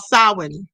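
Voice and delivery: "Samhain" said as SOW-win; two syllables in "Samhain", ending in win; corrected pronunciation. Shown as a demonstration. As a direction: say "Samhain" with a silent m, SAH-win. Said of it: "I'm saying it wrong. It's called".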